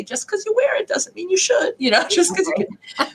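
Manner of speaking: high, teasing comeback with a laugh; teasing retort. Retold or imitated. Teasing her ex about his old disco suit, laughing. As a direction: tease him with a laugh. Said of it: "to which I say".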